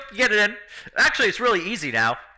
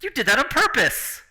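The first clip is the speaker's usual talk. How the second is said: indignant shout; 10 semitones higher, 4 dB louder than usual. Acted. NPC hit in the face.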